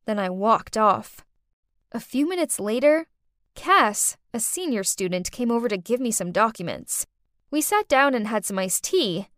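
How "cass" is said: ordinary delivery, nothing marked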